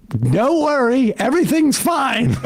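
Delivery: high, slow, falsely calm reassurance; mock calm panic. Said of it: "literally with their hair on fire".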